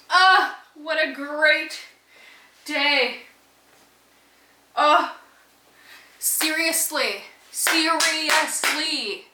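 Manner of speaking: real elated birthday gush; joyful gush. Real, not acted. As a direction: savor each word like pure bliss. Ecstatic birthday gushing.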